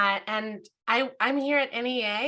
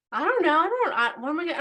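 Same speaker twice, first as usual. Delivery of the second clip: high, louder mock-defensive stammer; imitated helpless protest; 8 semitones higher, 5 dB louder, much faster than usual. Brother answering his sister's challenge about his name, helplessly defensive. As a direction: play a flustered brother, pitched up.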